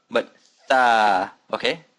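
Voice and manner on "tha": THA held long with the open A of fashion. Shown as a demonstration. Teacher correcting the short sound for learners, drawn-out THA. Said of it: "Remember that fashion sound".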